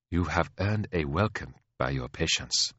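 gracious, softly spoken royal; courteous queen. Acted. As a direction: receive a visitor with quiet courtesy.

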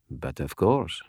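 put-on soft, suave French accent; a quiet Parisian agreement. A narrator's reading. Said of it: "He dropped the accent".

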